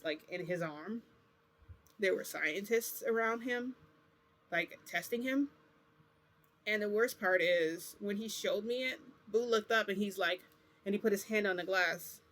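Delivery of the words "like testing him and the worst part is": ordinary talk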